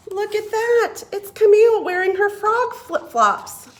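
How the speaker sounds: high, delighted reveal; excited. A narrator's reading. Reader showing children Camille in flip-flops, excited. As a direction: sound thrilled to see her.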